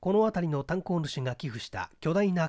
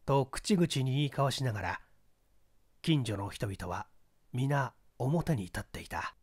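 ordinary delivery

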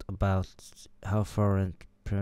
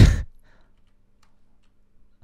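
breathy burst of laughter; loud unvoiced laughing fit; 7 dB louder than usual. Real, not acted. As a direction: crack up at your own joke. Laughs after shouting the choice.

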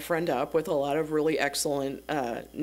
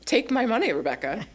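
mock-forceful, won't take no for an answer; playful insistence; 6 semitones higher than usual. Insisting the seller take money.

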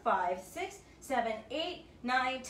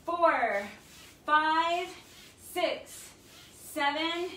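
energetic workout countdown; coach counting reps; 4 semitones higher, much slower than usual. Acted. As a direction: call the count out like a fitness coach.

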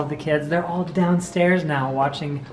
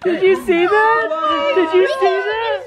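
excited disbelief; 11 semitones higher than usual. Real, not acted. Amazed at a card play.

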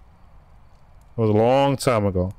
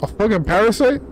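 scared shout; 11 semitones higher than usual. Real, not acted. Gamer facing a monster, shouting high in fright. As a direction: cry out in fright at the creature.

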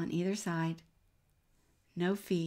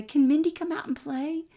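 put-on higher, louder child's plea; a kid begging to play with the dog; eager kid asking; 8 semitones higher, 6 dB louder than usual. Neighbor kids at the door asking for the dog, eager and high.

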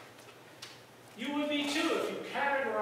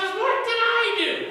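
high, loud, slow wounded cat voice; hurt cat; 11 semitones higher, 9 dB louder, slower than usual. Acted. The kicked cat asking the boy, high and hurt. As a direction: play the kicked cat, stunned. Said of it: "the cat looks around and says".

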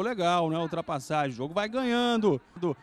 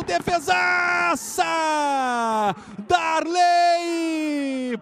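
excited shout; 7 semitones higher, 3 dB louder, much slower than usual. Real, not acted. Great save shouted.

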